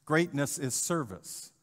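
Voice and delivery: ordinary talk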